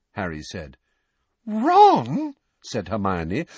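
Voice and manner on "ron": much higher, stunned question; jaw-dropped disbelief; astonished. A narrator's reading. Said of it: "her jaw dropping".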